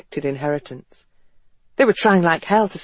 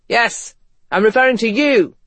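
higher, emphatic call-out; single them out with raised pitch; pointed accusation; 6 semitones higher, 5 dB louder than usual. Acted. West to the crowd of portraits, pointed accusation.

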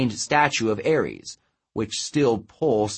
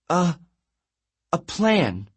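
higher, halting stammer; 7 semitones higher, slower than usual. A narrator's reading. Girl groping for a plan as the giant nears, stammering. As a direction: stumble and pause before the words. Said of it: "she stammered".

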